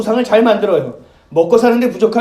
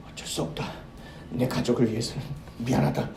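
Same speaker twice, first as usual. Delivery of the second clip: near-whispered, soft apology; hushed betrayal line; 8 semitones lower, 12 dB quieter than usual. Retold or imitated. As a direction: say it low like a sorry traitor. (숨죽여 속삭이는 배신자의 말)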